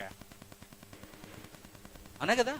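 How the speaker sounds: slow, drawn-out whispered aside; whispered knowing question. Acted. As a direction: breathe the question out, no voice.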